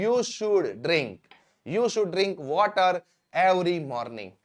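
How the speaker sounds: very slow, word by word, for learners; slow dictation. Shown as a demonstration. Teacher to students, slow clear dictation. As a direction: spell it out slowly so students can write. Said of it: "teacher dictates English model sentence".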